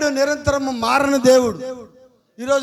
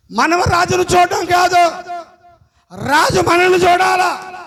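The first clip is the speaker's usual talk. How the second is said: loud, higher, driving sermon climax; fiery preaching; 6 semitones higher, 5 dB louder than usual. Acted. Preacher exhorting the congregation, loud and fervent. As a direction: preach it out to the whole hall.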